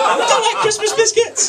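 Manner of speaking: very high, loud hammed-up yell; mock fury. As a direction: scream it in comic outrage. Same line yelled in mock fury.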